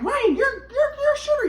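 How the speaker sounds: high mock mother's voice; imitating mom. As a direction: mimic mom nagging high-pitched. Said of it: "she's like".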